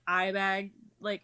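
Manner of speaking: ordinary talk, nothing marked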